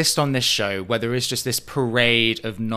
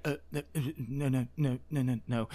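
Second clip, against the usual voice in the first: soft head-shaking denial; 9 dB quieter than usual. Retold or imitated. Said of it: "shake her head in that way she does".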